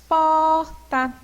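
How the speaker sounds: PORTA with a long, loud POR, then a short TA; teacher stretching porta to show its strong first syllable; stressed first syllable. Shown as a demonstration. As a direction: hold and punch the POR in porta. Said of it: "qual que é mais forte?".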